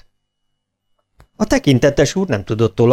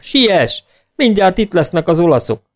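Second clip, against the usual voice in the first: higher, hurried warning; urgent call; 7 semitones higher than usual. A narrator's reading. Maharaja urging the bear to flee, urgent call. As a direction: urge him to hurry. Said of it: "kiáltotta".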